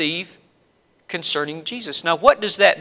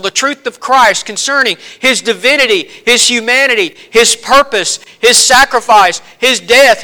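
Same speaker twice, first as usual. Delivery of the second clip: rising, louder preacher's litany; preaching at full force; 5 semitones higher, 5 dB louder than usual. Acted. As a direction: build each phrase like a revival preacher. Preaching the gospel truths.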